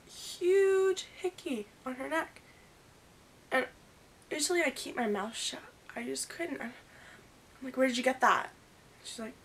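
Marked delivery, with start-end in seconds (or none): 0.1-2.4 slow, drawn-out, high disbelief; shocked. Real, not acted. Telling her friends about the hickey, slow shocked disbelief. 7.8-8.5 confronting. Retold or imitated. Confronting Milan about the hickey.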